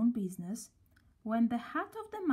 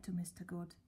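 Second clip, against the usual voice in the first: usual speaking voice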